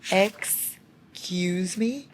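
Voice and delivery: "Excuse me?" stretched very slow, rising at the end; drawn-out disbelief. Real, not acted. Reader repeating her shock, slow and drawn out. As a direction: drag it out, stunned. Stunned by romantic line.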